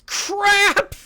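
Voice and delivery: high, loud startled cry; alarmed yelp. Real, not acted. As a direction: blurt it out in sudden panic. Sudden alarm near neutron star.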